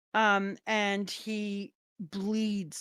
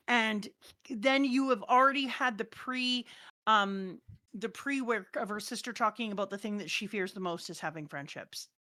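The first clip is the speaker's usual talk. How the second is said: normal talking voice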